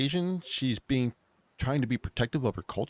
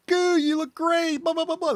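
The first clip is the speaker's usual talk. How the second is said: gushing praise; 4 dB louder than usual. Retold or imitated. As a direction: coo over him like he's adorable. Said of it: "they're all like".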